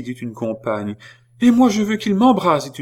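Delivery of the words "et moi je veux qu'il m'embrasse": high, loud eager voice; excited young woman. Acted. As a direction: gush it out. A third woman.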